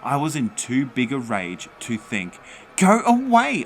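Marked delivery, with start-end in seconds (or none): 2.8-3.6 very high, very loud scream; top-volume raging shriek; furious shriek. Acted. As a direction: scream the ghost away. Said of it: "I shrieked at the old ghost".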